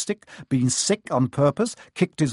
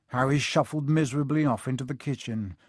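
ordinary delivery, nothing marked

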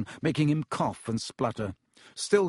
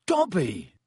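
higher, astonished exclamation; sudden gasp of recognition; startled recognition; 5 semitones higher than usual. A narrator's reading. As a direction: blurt the name in surprise.

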